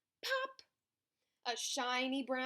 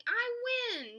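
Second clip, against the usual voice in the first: very high victory cry; triumphant shout; 14 semitones higher, 5 dB louder than usual. A narrator's reading. Squirrel winning the race, triumphant shout. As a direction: crow with triumph. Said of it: "shouted Pecan triumphantly".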